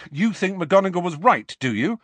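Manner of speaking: high, loud accusing question; angry. A narrator's reading. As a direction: challenge a friend angrily. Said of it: "said Harry angrily to the picture".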